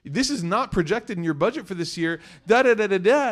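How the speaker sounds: high nervous advisor, trailing off in da da da; nervous financial advisor. Retold or imitated. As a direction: play the anxious advisor pleading to reconsider. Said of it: "He's like".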